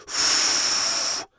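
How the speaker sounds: long unvoiced blow through the lips; blowing into wand. A narrator's reading. Fífa and the fairy blowing bubbles, unvoiced blow. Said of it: "foukl ještě víc".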